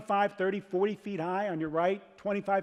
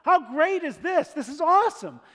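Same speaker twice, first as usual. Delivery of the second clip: higher and louder, whooping with delight; joyful shouting; 8 semitones higher, 6 dB louder than usual. Retold or imitated. Joyful Israelite crossing the Red Sea, shouting praise. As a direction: shout it like you're laughing through the sea. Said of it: "just laughing, going".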